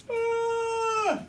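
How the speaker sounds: high, loud mock baby cry; fake baby wail. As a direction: wail like a baby faking tears.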